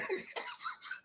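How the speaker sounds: high, soft wheezy laughter; laughing. Real, not acted. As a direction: crack up until the voice gives out. Cracks up at his own voice.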